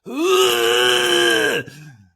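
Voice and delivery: loud, strained animal bellow from the throat; koala grunt. Retold or imitated. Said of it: "a koala's like a".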